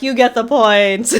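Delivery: loud, drawn-out cheer of approval; hammed-up, stretched-out announcement; mock loud awarding. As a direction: slowly proclaim it loud, imitating the judges. Retold judges cheering the comedian.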